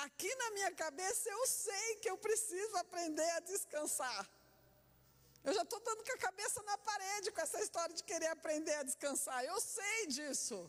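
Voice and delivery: high-pitched, comically frustrated protest; exasperated. Acted. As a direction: protest as if fed up with being told. Voicing frustration at being told to rest.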